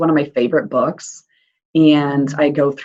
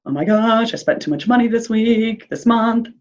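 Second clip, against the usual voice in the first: anxious response; 5 semitones higher than usual. Retold or imitated. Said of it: "other response of".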